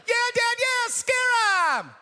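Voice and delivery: put-on very high, loud, drawn-out girlish cheer; hammed-up squealing sisters; excited sisters. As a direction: egg Dad on in a mock girls' shriek.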